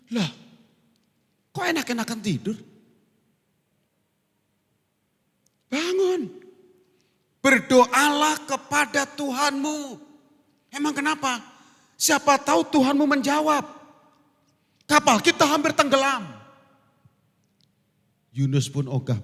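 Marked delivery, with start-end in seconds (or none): 0.1-9.8 high, loud rousing call; captain scolding. Acted. Ship captain rousing sleeping Jonah, high and urgent. 10.7-16.3 high, loud pleading; urgent captain. Acted. Captain urging Jonah to pray as ship sinks.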